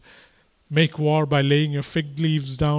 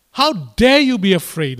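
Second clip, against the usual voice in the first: louder, pressing on dare; God's indignant challenge; 4 semitones higher, 7 dB louder than usual. Acted. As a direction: throw the question out like a challenge. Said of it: "is what he's asking".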